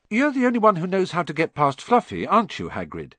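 a touch of worry in the question; mildly anxious, pressing for reassurance; lightly worried boy asking his friend. Acted. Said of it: "said Harry anxiously".

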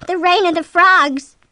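high, loud, drawn-out giddy cry; shrill excitement. A narrator's reading. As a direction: proclaim it on the edge of giggles. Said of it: "giggled shrilly".

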